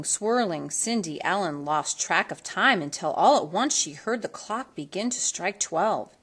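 usual speaking voice

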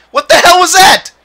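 high, loud incredulous cry; high loud outraged question; outraged disbelief. Real, not acted. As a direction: shriek it in disbelief. Tired player kept on ice.

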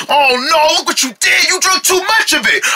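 high, rushed alarm; panicked blame. Acted. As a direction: panic at what they have done. Panicked blaming over potion overdose.

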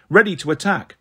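usual speaking voice

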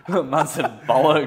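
slow, laughing disbelief. Real, not acted. Man laughing at his friend saying the rude word. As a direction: laugh it out slowly.

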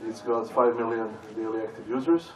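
ordinary talk, nothing marked

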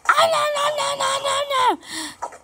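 high panicked no. Real, not acted. Losing in the game.

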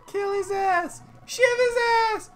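high squeaky voice. Acted. High-pitched character voice.

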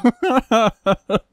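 real running chuckle; laughing. Real, not acted. Amused by bugs in the cave, laughter. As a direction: burst out chuckling. Laughs at his own joke.

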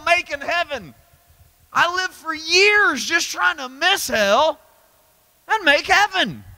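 high, driving preacher's cry; full-force preaching. Acted. Preacher crying out to the congregation, full force. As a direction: preach it hard to the crowd.

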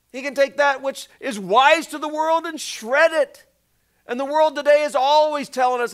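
high, loud fervent preaching. Acted. Preacher urging the congregation, rising fervor. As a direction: lift the room with urgency.

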